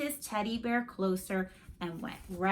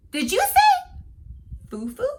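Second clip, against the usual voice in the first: high, loud disbelieving question; can't believe the name; surprised; 8 dB louder than usual. Acted. Boy surprised at his friend's teddy bear name.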